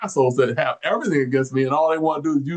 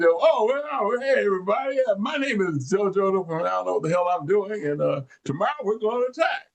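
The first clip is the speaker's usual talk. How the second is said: high, hammed-up clueless officer; lightly voiced green officer announcing an attack; clueless West Point officer addressing the troops, mock cheerful; 7 semitones higher than usual. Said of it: "Somebody says".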